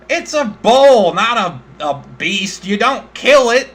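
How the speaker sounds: high, loud demanding farmer voice; indignant farmer. Acted. Farmer demanding compensation for his dead bull, high and loud. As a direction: insist on being paid, outraged.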